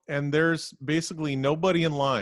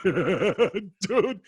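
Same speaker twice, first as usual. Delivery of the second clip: high burst of laughter into a loud dude; real laugh-shout; 3 semitones higher, 3 dB louder than usual. Real, not acted. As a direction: crack up and shout it out. Laughing at his own story.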